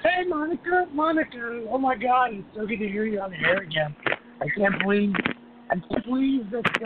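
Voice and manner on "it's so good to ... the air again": usual speaking voice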